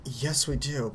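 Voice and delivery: usual speaking voice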